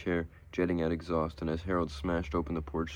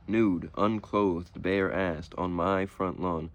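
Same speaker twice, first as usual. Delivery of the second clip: slow, louder, each word hammered out; spelling it out; 5 dB louder, much slower than usual. A narrator's reading. Harold spelling it out to the police sergeant, slow and emphatic. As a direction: drag every word out for the slow cop.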